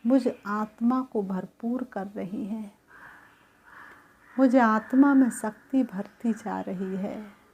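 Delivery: calm, even meditation guidance; guided meditation. Acted. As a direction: lead the soul gently inward and back. Leads a guided soul meditation.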